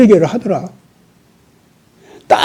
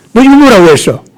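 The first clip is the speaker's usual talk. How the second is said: acted sharp retort, raised pitch; confrontational challenge; 3 semitones higher, 4 dB louder, faster than usual. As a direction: challenge them as if calling out an insult. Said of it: "그러면서 막 따지는 거야".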